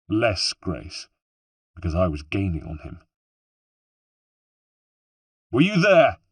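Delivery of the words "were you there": high, loud challenge; accusing shout, pitched well up; shouted demand. A narrator's reading. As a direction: shout the question after a fleeing man. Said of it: "I shouted at him".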